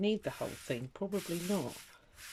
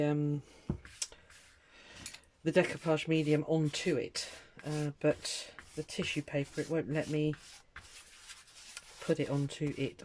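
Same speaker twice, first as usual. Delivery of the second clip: ordinary talk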